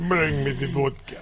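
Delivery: mock Russian vampire. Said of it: "sounding like a Russian vampire".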